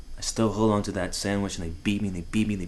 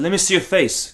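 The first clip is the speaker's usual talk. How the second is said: higher, insistent father; demanding father; 9 semitones higher, 4 dB louder than usual. Retold or imitated. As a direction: order the boy to show his face. Father demands to see his son's face.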